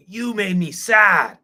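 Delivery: high, slow, loud mock blame; angry accusation. Imitating people who get sad and lash out, angry. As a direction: stretch it into an accusing yell. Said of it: "express anger".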